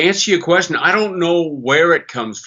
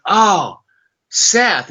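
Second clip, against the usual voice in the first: delighted greeting; 7 semitones higher than usual. Retold or imitated. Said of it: "and I went".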